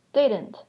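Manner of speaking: "Didn't" falling sharply from high to low; "Didn't" said high then falling low; falling tune. Shown as a demonstration. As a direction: drop the pitch down across "Didn't". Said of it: "That goes from a high pitch to low pitch".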